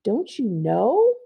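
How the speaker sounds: very slow, drawn-out menacing witch. Acted. Witch teases the girl with slow, menacing words. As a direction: savour each word like a creepy witch.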